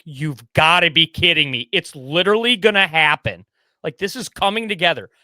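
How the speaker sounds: can't believe your luck; thrilled disbelief. Retold or imitated.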